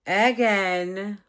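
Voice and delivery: loud, stressed "again"; emphatic again. Retold or imitated. Complaining to the care manager, a loud "again". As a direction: hit "again" hard.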